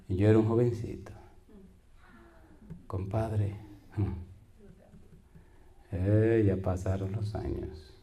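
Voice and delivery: ordinary delivery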